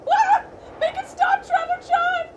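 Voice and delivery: loud, very high plea; terrified screaming. Acted. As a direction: scream for rescue. Terrified in growing wind.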